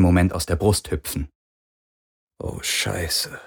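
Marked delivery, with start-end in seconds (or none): 2.4-3.4 soft, voiceless croak. A narrator's reading. Prisoner with a bone-dry throat reacts to bad news, croaking softly. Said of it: "krächzte er".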